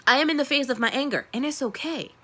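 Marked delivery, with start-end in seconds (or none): none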